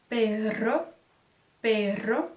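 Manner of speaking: usual speaking voice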